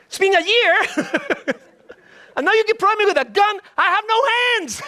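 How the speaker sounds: high, fast, fed-up complaint; exasperated outburst. Acted. Warehouse guy yelling at the developers, high and fed-up. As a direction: blow up at them, voice cracking high with disbelief.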